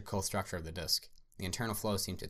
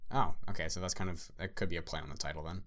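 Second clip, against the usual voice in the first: ordinary delivery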